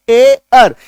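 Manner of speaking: ए अर said loud and separate; stressed sound demo. Shown as a demonstration. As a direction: punch out ए अर as two sounds. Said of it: "वो क्या होता है?". (ए अर को ज़ोर से अलग-अलग बोलें)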